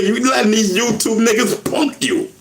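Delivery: high-pitched imitated indignation; retold anger. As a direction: imitate an ex scolding him. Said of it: "she said she was mad".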